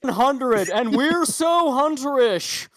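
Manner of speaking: loud, slow, high anchor voice; newscaster dirty talk. Retold or imitated. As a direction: shout it like a sleazy news host. Continues newscaster bit.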